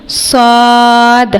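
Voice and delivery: صاد full-mouthed and heavy, with a hiss; heavy whistling letter demo. Shown as a demonstration. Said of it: "اس کو ہم موٹا ادا کریں گے". (صاد موٹا اور سیٹی کے ساتھ)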